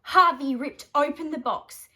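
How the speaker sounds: usual speaking voice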